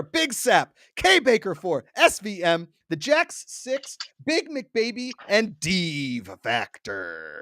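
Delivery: high, stretched-out name reveal, dropping on the last; drawn-out hammed-up announcer read; announcer roll call. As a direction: call the winners like an emcee. Announcer-style roll call of winners.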